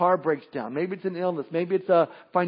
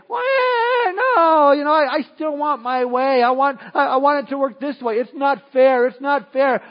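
put-on high, loud childish whining with a crying edge; whiny complaint; 7 semitones higher, 6 dB louder than usual. Believer whining at God, high tearful complaint. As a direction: sulk like a thwarted child. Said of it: "And we say".

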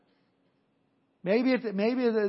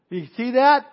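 ordinary talk, nothing marked

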